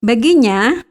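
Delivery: ordinary delivery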